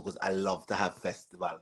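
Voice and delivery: ordinary talk, nothing marked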